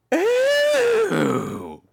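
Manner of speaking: high scandalised gasp and "oh"; be utterly scandalised; shocked British lady. Retold or imitated. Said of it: "the conversations are always like".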